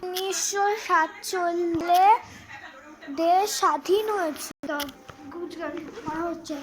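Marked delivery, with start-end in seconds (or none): 0.0-4.7 loud, slow, word by word recitation; reciting answer. Acted. Daughter answers mother's quiz in a loud, slow recitation. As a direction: recite it proudly like a schoolchild.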